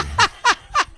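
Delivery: sudden high laugh; host laughing at the singer's joke; high burst of laughter. Real, not acted. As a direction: crack up at the joke.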